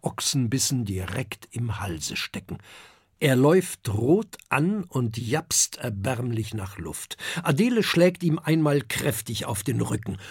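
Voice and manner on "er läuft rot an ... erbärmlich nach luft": ordinary delivery, nothing marked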